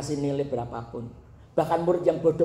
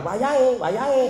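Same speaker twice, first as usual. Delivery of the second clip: excited call; 9 semitones higher, 4 dB louder than usual. Retold or imitated. Child runs off calling.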